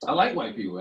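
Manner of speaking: ordinary talk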